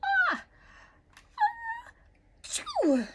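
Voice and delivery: high, loud, building ah-ah-achoo; enormous sneeze. Acted. The bear sneezing the mitten into the sky, a big loud sneeze. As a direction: wind up and blast out a huge sneeze. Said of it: "gave an enormous sneeze".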